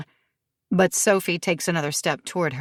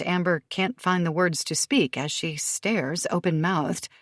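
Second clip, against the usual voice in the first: ordinary delivery, nothing marked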